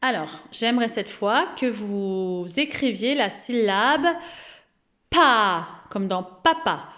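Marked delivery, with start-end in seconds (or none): 5.1-5.7 "pa" with a long a, louder; exaggerated pronunciation. Shown as a demonstration. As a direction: pop the p, then hold the a of "pa". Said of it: "d'exagérer la prononciation". (« pa » exagéré, a allongé)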